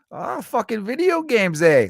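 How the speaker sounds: high, slow mock Canadian accent. Mock Canadian accent as a Canadian buddy hears about video games. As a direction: ham up a Canadian buddy's delight. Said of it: "Canadians, I feel like".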